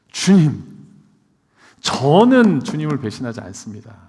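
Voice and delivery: loud, bold declaration as Peter; boastful vow. Retold or imitated. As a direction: swear loyalty loudly, full of bravado. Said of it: "호언장담을 합니다".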